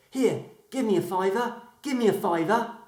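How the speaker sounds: high, pushy little voice; cheeky talking ankle. Acted. Talking ankle begs the doctor, high and cheeky. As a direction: beg for the fiver.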